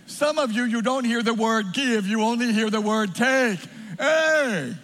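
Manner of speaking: loud, high-pitched preacher's cry; full-force preaching. Real, not acted. Preacher confronting congregation on giving and taking, full-force preaching. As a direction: drive the point home to the congregation at full voice.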